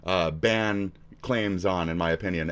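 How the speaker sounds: ordinary delivery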